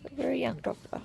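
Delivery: teasing whisper, under the breath. Real, not acted. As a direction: whisper it aside to yourself. Repeats the compliment to doctor.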